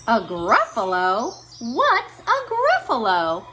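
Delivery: puzzled fox wants to know. Acted. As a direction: fox puzzled about the Gruffalo. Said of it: "Fox, but no".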